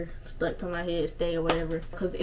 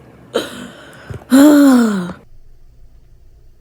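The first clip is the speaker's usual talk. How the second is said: loud exasperated sigh; 4 semitones higher, 14 dB louder than usual. Real, not acted. Exasperated at lost hair.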